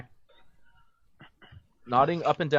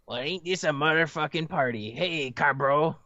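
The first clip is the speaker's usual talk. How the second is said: stoner voice; 3 semitones higher than usual. Acted. Gamzee greeting in stoner voice.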